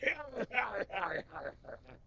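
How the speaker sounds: high pirate cackle trailing down; villainous sea-dog laugh; hammed-up pirate laugh. Pirate laughs after his threat.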